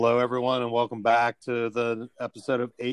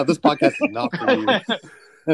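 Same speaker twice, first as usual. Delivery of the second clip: laughing, slow and higher; 8 semitones higher, 3 dB louder, slower than usual. Real, not acted. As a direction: chuckle through the put-down. Laughing retort.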